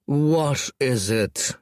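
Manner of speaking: slow, bored dragon-lord drawl; the lord drags out his question to his lieutenant. A narrator's reading. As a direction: stretch every word out, unimpressed. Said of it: "drawled Scraggletooth".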